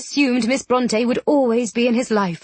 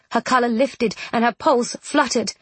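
plain reading voice

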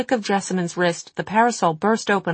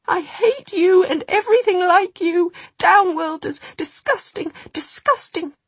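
shriek; 13 semitones higher, 3 dB louder than usual. A narrator's reading. Jessamyn shrieking at the goblin, thin and trembling. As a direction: shriek it with hatred. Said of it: "her voice thin and trembling".

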